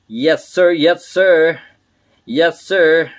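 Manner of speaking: loud, high "yes, sir"; exultant loud repeats; hyped cheer. Real, not acted. Cheering his success.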